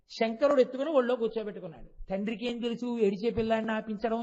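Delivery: normal talking voice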